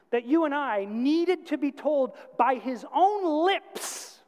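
high, forceful preaching voice; emphatic preaching. Real, not acted. Preacher affirms the Word of God to his congregation, emphatic preaching. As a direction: drive home each point from the pulpit.

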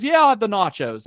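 imitated booming reply; loud order. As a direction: imitate someone ordering for all to hear. Retold loud order called to waiter.

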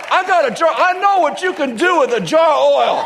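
high, emphatic proud declaration; hammed-up proud boast. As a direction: answer with total confidence. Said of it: "just be really proud".

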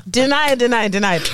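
ordinary talk

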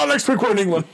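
high, bright mock announcer quip. Imitating the announcer's quick plug, announcer voice. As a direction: toss it off like a TV host.

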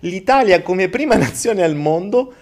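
ordinary talk, nothing marked

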